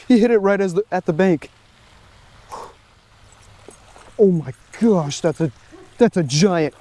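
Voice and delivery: high, loud, breathless fish-fight yelling; excited. Real, not acted. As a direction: shout it as the fish of your life hits. Landing a big bass.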